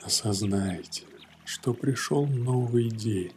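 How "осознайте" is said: ordinary talk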